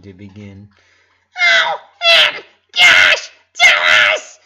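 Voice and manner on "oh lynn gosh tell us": high, loud squealing plea; barely contained excitement. A narrator's reading. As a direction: burst out, unable to hold it in. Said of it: "barely containing her emotions".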